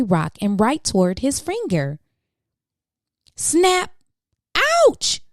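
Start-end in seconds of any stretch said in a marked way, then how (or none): 3.3-3.9 a sudden high snapping exclamation; sharp snap. Acted. Narrator reading to kids snaps the word as the crab strikes, high and sudden. 4.5-5.2 a yelp of startled pain; sudden ouch. Acted. George yelps as the crab pinches his finger, a high pained squeal.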